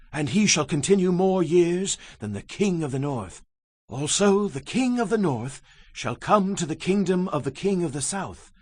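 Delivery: ordinary delivery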